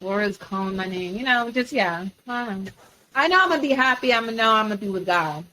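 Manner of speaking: ordinary talk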